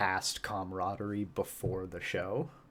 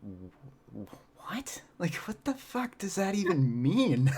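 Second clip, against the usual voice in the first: baffled whisper to outburst; 8 semitones higher, slower than usual. Retold or imitated. Partner says he seems off, he reacts whispered then exasperated. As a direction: start breathless, then burst out confused. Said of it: "I was like".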